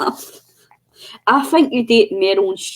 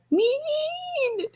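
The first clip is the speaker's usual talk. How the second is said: very high, soft, long drawn-out whine; imitating little girl; 7 dB quieter than usual. Friend mimicking the child's cute drawn-out mean. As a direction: stretch the word like a sulky wee girl. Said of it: "wee cute Irish accent".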